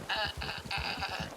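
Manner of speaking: high, chattering dolphin squeaks; short high-pitched dolphin calls; dolphin impression. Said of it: "That's my dolphin impression".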